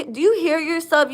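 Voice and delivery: ordinary delivery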